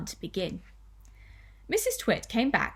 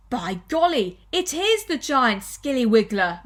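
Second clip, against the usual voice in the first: loud, higher-pitched exclamation; mock alarm; 3 semitones higher, 6 dB louder than usual. Husband scaring his wife with the skilly wiggler, mock alarm. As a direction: build to a loud, astonished announcement.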